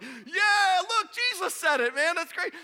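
high, loud hyped-up cheer; psyched. Acted. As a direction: burst out like a thrilled fan. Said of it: "I'm really psyched about that".

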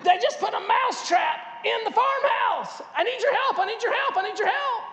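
high, loud, frantic mouse voice; panicked mouse. Retold or imitated. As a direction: rush the plea to the pig in a high panic. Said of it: "and he said".

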